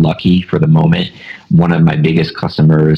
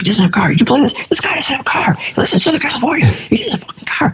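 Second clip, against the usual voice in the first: much higher, breathy ranting disbelief; mock raging boss; faster than usual. Boss ranting to owner about the carless hire, shrill imitated rage. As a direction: squeal it out like a boss losing it. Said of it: "swearing, he's, like, going nuts".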